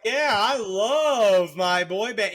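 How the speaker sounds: slow, dragged-out sarcastic drawl; deadpan mock enthusiasm, stretched. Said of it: "the sarcasm".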